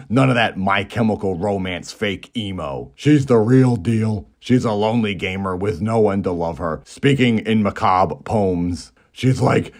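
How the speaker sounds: normal talking voice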